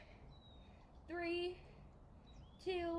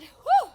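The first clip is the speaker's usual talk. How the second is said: a loud, high whoosh of breath after the jumps; out of breath whew; 14 semitones higher, 20 dB louder than usual. Real, not acted. Coach catches her breath after star jumps, loud whew. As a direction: blow it out, winded and relieved. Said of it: "They really get me out of breath".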